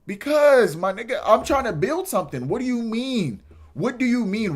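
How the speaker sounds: high, loud burst of disbelief; loud, incredulous protest; exasperated outburst. Real, not acted. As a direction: blurt it out in frustration. Exasperated outburst at her question.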